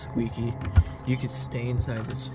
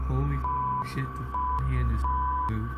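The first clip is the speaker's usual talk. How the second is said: adrenaline shakes. Real, not acted. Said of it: "Fucking hands are shaking".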